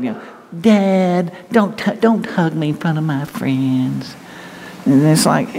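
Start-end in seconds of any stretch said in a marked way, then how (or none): 0.6-4.1 put-on higher, whining teen protest dragged out slowly; whiny teenager. Teenager begging dad not to hug him in public, whiny. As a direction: whine it like an embarrassed kid. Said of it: "I've heard that".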